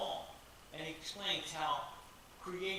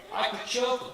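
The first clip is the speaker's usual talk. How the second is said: louder, higher burst on choke; exasperated outburst; 6 semitones higher, 11 dB louder than usual. Real, not acted. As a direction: let the frustration spill over on choke. Venting frustration at the men.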